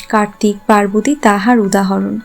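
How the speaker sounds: plain reading voice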